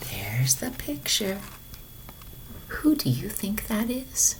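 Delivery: soft teasing whisper aside. Acted. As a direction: hush it to the child listening. Reader aside to listener.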